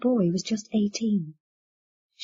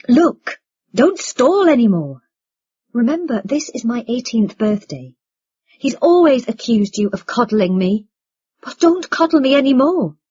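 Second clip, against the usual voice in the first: impatient, raised-voice outburst; crying out; 4 semitones higher, 6 dB louder than usual. A narrator's reading. Impatient son confronts his mother, crying out. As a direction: burst out loud and high, demanding an end to stalling.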